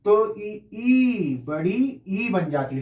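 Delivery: "ई, ई" said slowly, then the long "बड़ी ई"; vowel demo. Shown as a demonstration. Teacher shows students how two short vowels join. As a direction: stretch "ई" out to show the long vowel. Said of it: "जब आप ई का उच्चारण दो बार करेंगे".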